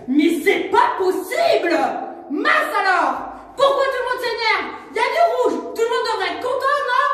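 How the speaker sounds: loud, high, furious outburst; angry outburst. Acted. Cali loses her temper in the street, loud and high. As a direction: blow up at everyone. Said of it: "sortir une énorme voix et s'énerver".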